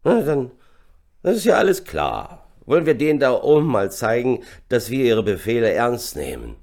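loud, high, tipsy bravado; rally the men, slurring a little; drunken slur. A narrator's reading. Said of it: "lallte der Unteroffizier". (lallend, angetrunken und laut)

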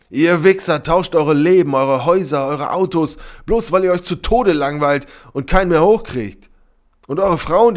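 high, loud, raging accusation; furious tirade. A narrator's reading. Sybil condemns the club men, furious. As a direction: let the rage pour out at them.

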